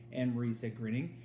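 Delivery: usual speaking voice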